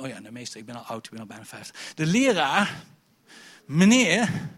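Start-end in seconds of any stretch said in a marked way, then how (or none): 0.0-1.9 put-on weary old teacher. Teacher voiced as weary old man.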